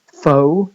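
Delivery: "pho" said loud as a full foh; main stress. Shown as a demonstration. Lecturer noting the main stress, stressed "pho". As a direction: hit "pho" hard with an oh vowel. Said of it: "the main stress".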